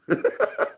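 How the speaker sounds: mocking laugh. Real, not acted.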